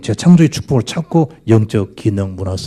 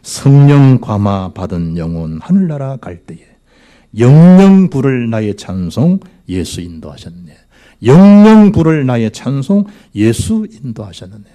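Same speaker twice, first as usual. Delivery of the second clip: slow, declaimed hymn lines, first word of each swelling loud; hymn recitation; 3 semitones higher, 6 dB louder, slower than usual. Real, not acted. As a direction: recite the hymn with weight, stretching the words. Recites hymn lyrics aloud. (찬송가 가사를 느리고 힘있게 낭송)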